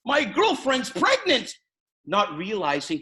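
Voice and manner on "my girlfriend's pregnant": high, loud, drawn-out shout of big news; re-enacted excited yell, pitched way up; excited scream. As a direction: holler it to your wife from the door. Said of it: "I scream at my wife".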